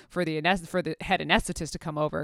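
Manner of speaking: ordinary talk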